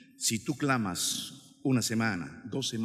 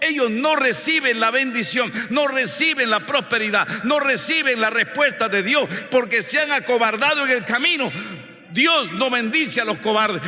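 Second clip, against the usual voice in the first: high, loud pulpit declamation; full-force preaching; 8 semitones higher, 8 dB louder than usual. Real, not acted. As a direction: drive each phrase home like a fiery preacher.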